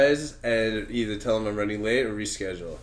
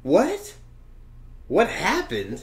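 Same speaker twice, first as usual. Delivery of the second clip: mock confusion; 7 semitones higher, slower than usual. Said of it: "Yo, what's up, man?".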